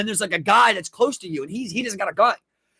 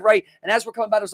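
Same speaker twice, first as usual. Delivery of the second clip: ordinary talk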